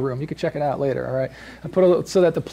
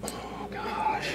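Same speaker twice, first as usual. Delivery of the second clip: breathy whispered dismay, much softer; whispered groan; 13 dB quieter than usual. Retold or imitated. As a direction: sigh it out under your breath. Groaning at ruined wall daily.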